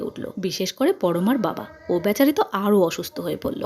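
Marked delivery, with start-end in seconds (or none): none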